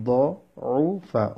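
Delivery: দউফা very slow, in three parts: দ, উ, ফা; syllable by syllable. Shown as a demonstration. Teacher breaking down a hard word, syllable by syllable. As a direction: pull দউফা apart with pauses.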